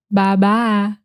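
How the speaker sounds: "bye-bye" as Southern "bah-bah"; Southern accent. Shown as a demonstration. Teacher showing how Southerners say bye-bye, Southern accent. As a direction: flatten both vowels of "bye-bye" into a Southern "ah". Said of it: "they would say".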